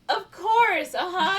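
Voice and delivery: high, loud mock agreement; humoring him. Everyone placating the man who insists, humoring him. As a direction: play along in an exaggerated high voice. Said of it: "trying to humor him".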